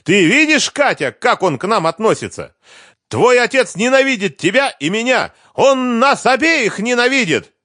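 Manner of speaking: high, loud screamed appeal; furious mother at full pitch; hysterical accusation. A narrator's reading. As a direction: yell it to the child against her father. Wife screams accusations to child.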